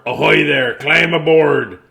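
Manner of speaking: loud, slow hollered call; pirate holler. Acted. Pirate crew calling to Small Saul, loud hollered invitation. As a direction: call out from the ship to the dock. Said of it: "a voice hollered".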